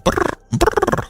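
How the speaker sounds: breathy whistled bird trill. Pretend. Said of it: "Yo, I made a bird noise".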